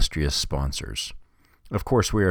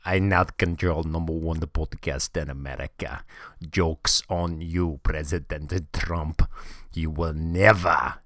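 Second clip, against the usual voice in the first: Sergey's bad Russian accent. Russian hacker mocks President Trump, in a hammed-up Russian accent. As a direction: gloat like a cartoon Russian villain in a thick Russian accent. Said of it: "I come up with this accent".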